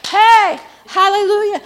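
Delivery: loud, high preacher's cry; impassioned preaching. Acted. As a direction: preach it at full force, stretching the key words. Preaches at full force.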